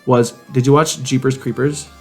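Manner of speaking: usual speaking voice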